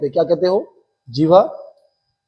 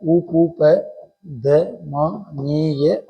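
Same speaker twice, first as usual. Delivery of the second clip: slow drawn-out; 4 dB quieter, much slower than usual. Shown as a demonstration. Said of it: "teacher draws the term out".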